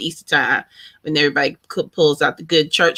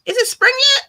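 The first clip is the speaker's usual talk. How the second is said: surprised question; faster than usual. Real, not acted. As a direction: ask it as if you can't believe it.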